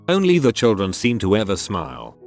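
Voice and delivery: ordinary delivery, nothing marked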